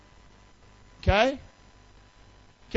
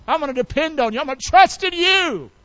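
climbing to a high, fervent cry; fervent declaration; 7 semitones higher than usual. Acted. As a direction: build up into a vow to God.

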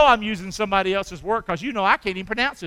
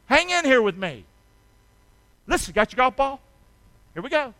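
ordinary talk, nothing marked

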